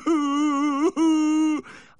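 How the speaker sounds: loud, high, drawn-out wail; hammed-up boo-hoo wail; howling sob. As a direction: break into a long theatrical sob. Owner breaks into wailing.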